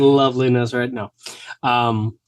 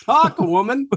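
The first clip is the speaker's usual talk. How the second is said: high, mock-impatient command; imitated exasperated old man; annoyed husband; 11 semitones higher, slower than usual. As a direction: order the wife to speak, as a comic cranky husband.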